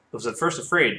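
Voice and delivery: plain reading voice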